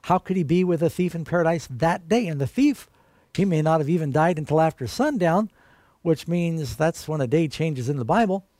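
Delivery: ordinary talk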